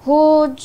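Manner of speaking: হোজ pushed loud but stopping on জ; forced হোজ that won't lengthen; failed stretch. Shown as a demonstration. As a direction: try to drag হোজ out and fail. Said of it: "ওরম টানা যা-, যাচ্ছেই না".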